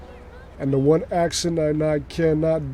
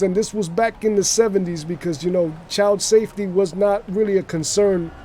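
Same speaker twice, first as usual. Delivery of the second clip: raised-pitch New York wise guy patter; wise guy storyteller; 5 semitones higher, 3 dB louder than usual. Pretend. Wise guy recalling family car trips, chatty put-on accent. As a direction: ramble like a mobster reminiscing. Continues invented story in character.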